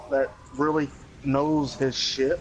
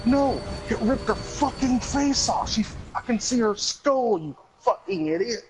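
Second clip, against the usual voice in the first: much higher, exasperated mock yell; mock angry outburst; 9 semitones higher than usual. Caller snapping at the dispatcher, high mock angry yell. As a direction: imitate a caller losing her temper. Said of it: "the woman finally gets mad and goes".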